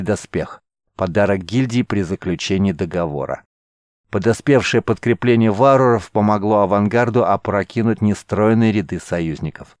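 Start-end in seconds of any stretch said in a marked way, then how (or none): none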